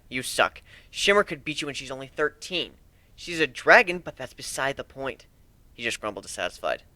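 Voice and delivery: usual speaking voice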